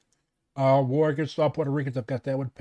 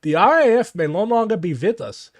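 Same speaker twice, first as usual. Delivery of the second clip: put-on movie villain German accent. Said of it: "this ridiculous German accent while reading from this".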